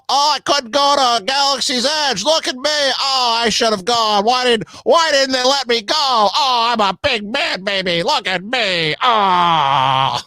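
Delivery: high, whiny, hammed-up mock lament.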